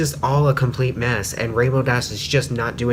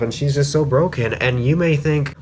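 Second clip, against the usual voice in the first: ordinary talk, nothing marked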